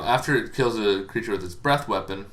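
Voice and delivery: ordinary talk, nothing marked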